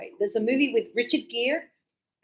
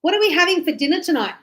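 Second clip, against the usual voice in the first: light and quick, raised a little; quick casual question; 6 semitones higher, faster than usual. Shown as a demonstration. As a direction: toss it off quickly to your daughter. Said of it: "look what I said".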